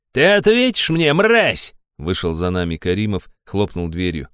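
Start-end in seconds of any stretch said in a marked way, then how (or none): 0.1-1.7 high furious yelling; furious shout. A narrator's reading. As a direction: scream at him. Baron yells after hero.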